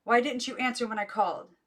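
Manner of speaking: loud high reproach; angry aunt. A narrator's reading. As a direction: demand it of the rude child. Aunt scolding after slap.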